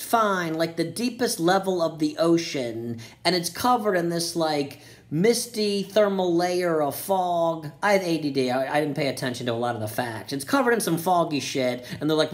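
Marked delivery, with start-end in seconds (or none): none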